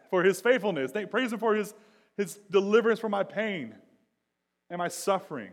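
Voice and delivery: high, loud, jubilant proclamation; exultant preacher at full voice; triumphant praise. Acted. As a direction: shout thanks as a grand finale. Acts out triumphant psalm ending.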